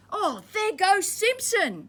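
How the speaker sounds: high, slow amazed call; amazed soldiers. Acted. Soldiers watch Simpson pass, calling out in high, slow amazement. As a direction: marvel as he walks by. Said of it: "they said".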